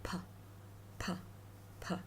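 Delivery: P as a short puffed "puh"; Chinese p sound. Shown as a demonstration. Teacher demonstrates the second initial, short puff. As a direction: say "p" as in Patrick, briefly. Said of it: "in Chinese is p".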